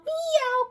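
very high, loud yowling meow; squealing hammed-up cat cry. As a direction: yowl like an angry cat. Animals scare off the robbers.

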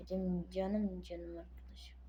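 tender whisper. Acted. Whispering tenderly to his game friend. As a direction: breathe it softly to the friend.

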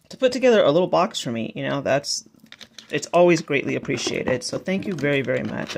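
normal talking voice

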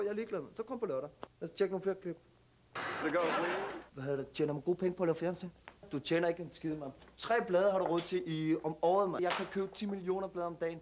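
put-on street slang Danish accent, cocky; perkerdansk accent. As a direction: talk like a tough kid from the suburbs, perkerdansk accent. Same immigrant youth character. (selvsikker perkerdansk accent)

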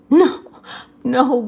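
loud high gasps; gasping. Acted. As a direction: gasp between fits of laughter. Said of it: "gasped the rhinoceros".

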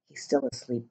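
very soft, low whisper. A narrator's reading. Watcher beside a sleeping giant, whispering. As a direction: drop to a hushed, low whisper. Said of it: "the cat whispered".

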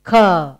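"K" as a phonics "kuh"; letter sound. Shown as a demonstration. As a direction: sound out "K" as the opening of cat.

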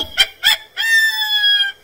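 drawn-out high-pitched laughter; delighted giggling fit; long high giggle. Real, not acted. Laughs at the game.

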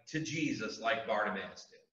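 ordinary talk, nothing marked

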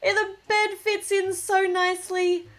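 ordinary talk

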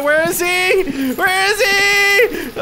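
high, slow, drawn-out worried calling; slow frightened where is he; anxious searching. Real, not acted. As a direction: stretch it out, scared and searching. Searching in panic.

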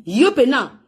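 ordinary delivery

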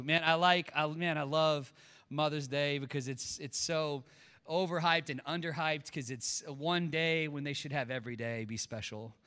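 normal talking voice

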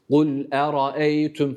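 kul eraeytüm drawn out slowly; slow careful kul eraeytüm; slow recitation. Shown as a demonstration. Tutor models the verse slowly for the student. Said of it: "Hah, şimdi oldu".

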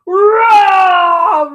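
loud, long tiger roar. A narrator's reading. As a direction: roar out big like a storybook tiger. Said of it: "roared the tiger".

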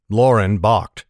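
plain reading voice

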